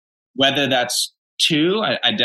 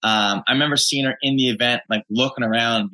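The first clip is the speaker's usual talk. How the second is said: usual speaking voice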